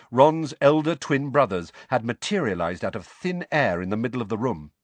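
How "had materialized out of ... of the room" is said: usual speaking voice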